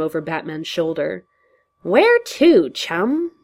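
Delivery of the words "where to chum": high, loud sing-song jeer; mocking chummy. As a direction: throw an arm round him, teasing. Joker mock-chummy.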